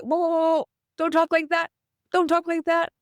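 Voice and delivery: high, urgent mock protest; high-pitched halting objection, acted out; acted alarmed interruption. Acted reaction cutting off negative talk.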